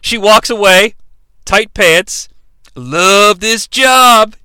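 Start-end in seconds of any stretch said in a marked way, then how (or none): 2.7-4.4 very slow savoring delivery; lightly voiced smug line. Nash watching Maya walk away, slow smug. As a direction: drawl it, pleased. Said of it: "he says".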